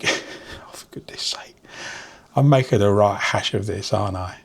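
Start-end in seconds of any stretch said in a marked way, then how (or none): 0.0-1.6 under-breath sigh of frustration; whispered exasperation. Real, not acted. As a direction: mutter it to yourself, exasperated. Frustrated aside to herself.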